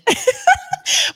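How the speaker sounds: squeaky high laugh; high giggle. Real, not acted. Host laughs at guest.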